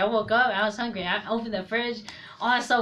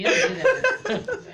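laughing protest; 5 dB louder than usual. Real, not acted.

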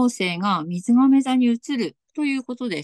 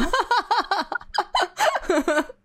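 laughing; 10 semitones higher than usual. Real, not acted. Guest laughs at own greeting.

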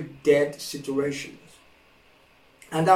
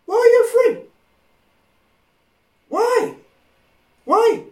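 much higher, pressed why; high, louder puzzled why; puzzled Jesus; 4 dB louder than usual. Retold or imitated. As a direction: ask it in baffled disbelief.

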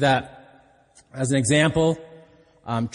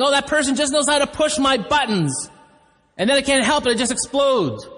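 higher-pitched mock self-justification; exasperated excuse; 9 semitones higher than usual. Preacher voicing people excusing their bad attitude, exasperated. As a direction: plead helplessness in a raised pitch.

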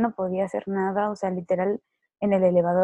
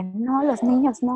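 higher, slow, mock-pleading whine; whiny protest; 5 semitones higher, 4 dB louder, slower than usual. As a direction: stretch it out like a feverish child fretting. Worrying about kids while sick. (queja lloriqueada en broma, aguda y lenta)

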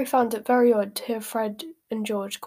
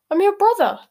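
high, loud sibling protest; indignant protest; 6 semitones higher, 6 dB louder than usual. Acted. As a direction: burst out in acted outrage. Ron protests at being charged.

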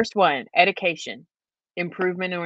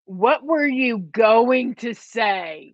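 slow, louder, drawn-out question; insistent prodding; 3 semitones higher, 5 dB louder, much slower than usual. Real, not acted. Co-host presses friend on the word she avoided, insistent prodding. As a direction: space the question out slowly and press.

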